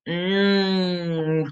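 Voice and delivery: "Mm" as a held, low N; soft sustained nnn for "Mm"; N sound. Shown as a demonstration. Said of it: "what's the N sound".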